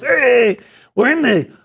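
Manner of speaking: high, laughing stoner drawl with a Chicano accent; [name] voice. Shown as a demonstration. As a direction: ham up a laid-back Chicano stoner. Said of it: "my best [name] voice".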